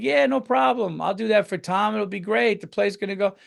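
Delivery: tossed-off confident promise; cocky easy agreement. Retold or imitated. Mimicking [name] agreeing.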